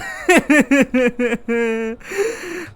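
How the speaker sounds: long, high giggling laugh. Real, not acted. Reader laughs at the brothers twist, giggling. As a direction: laugh helplessly in a long run.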